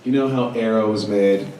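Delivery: ordinary talk, nothing marked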